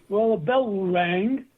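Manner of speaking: usual speaking voice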